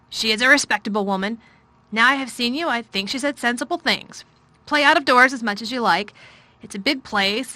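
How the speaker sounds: a wretched man explaining himself; troubled guardian's voice. A narrator's reading. Guardian explaining himself to Mary, loud and weary. Craven's speech.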